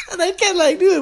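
very high, squeaky laughing speech; cracking up. Real, not acted. Telling listeners I can't do it, cracking up. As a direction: barely get it out for laughing. Laughing too hard to continue.

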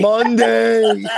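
real laugh through the word. Real, not acted. Laughing echo of friend.

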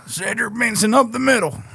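high-pitched football commentator call; play-by-play announcer. Acted. Fan imitates the commentary on a running back, announcer-style.

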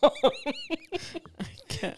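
squeaky high laughing cry. Real, not acted. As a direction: gasp it out mid-laugh. Laughing fit at story.

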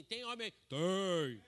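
hammed-up manly shout; manly call and shout. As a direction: call out, then bark back the reply like a crowd of men. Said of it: "Mas quando fala assim".

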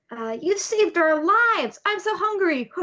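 put-on high, dramatic little-kid voice; hungry little babies.

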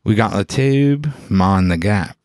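put-on Cockney-tinged London accent; broad London geezer voice. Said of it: "pretty classically a London thing".

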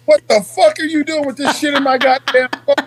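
playful outraged yell about the phone; mock angry yell. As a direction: yell at him through laughter, half playing mad. Angry retold shout at friend.

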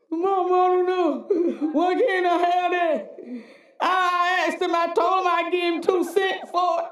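mock childish whine, laughing; whiny child. Preacher mocking the king crying to mama like a child. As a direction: whimper like a spoiled kid to his mama. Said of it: "Like, like evangelist children would do".

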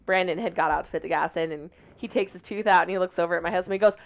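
ordinary talk, nothing marked

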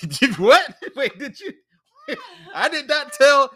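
high laughing protest; laughing disbelief. Real, not acted. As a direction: laugh while denying it.

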